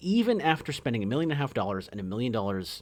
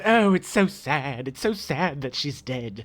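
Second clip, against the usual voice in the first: mock sad; slower than usual. Mock mourning voice.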